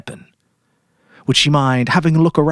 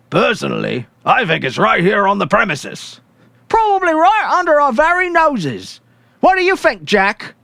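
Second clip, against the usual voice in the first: higher, louder blokes talking with their mouths full; hungry cops gabbing over dinner; gruff policemen chatting; 11 semitones higher, 4 dB louder than usual. Acted. Policemen eating the lamb and discussing the weapon, louder and higher.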